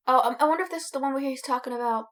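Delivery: ordinary talk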